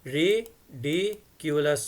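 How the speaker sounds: ridiculous said very slowly, each of its three syllables apart; three clear syllables. Shown as a demonstration. Teacher showing students: Ridiculous broken into three slow syllables. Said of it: "three syllables हैं इसमें".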